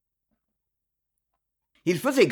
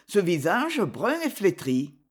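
usual speaking voice

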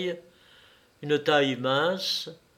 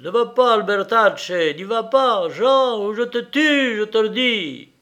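high, loud cried warning; urgent raised-voice threat; shouted threat; 8 semitones higher, 6 dB louder than usual. A narrator's reading. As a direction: shout to stop him, threatening. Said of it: "cria-t-il".

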